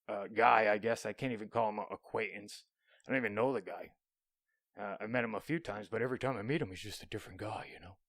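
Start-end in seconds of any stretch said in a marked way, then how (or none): none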